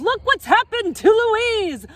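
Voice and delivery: high, loud panicked holler; hollering child. Acted. Joey shouting about his sister turned to rock, panicked. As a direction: yell it as a frightened kid. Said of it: "Joey grabs the rock and hollers".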